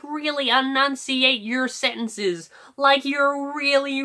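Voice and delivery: normal talking voice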